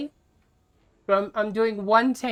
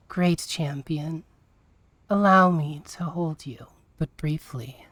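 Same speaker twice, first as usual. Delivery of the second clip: mommy voice; 5 semitones lower than usual. Pretend. Streamer performing a paid redemption for chat, low tender mommy voice. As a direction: play the doting mother, trailing into a whisper. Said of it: "in a mommy voice".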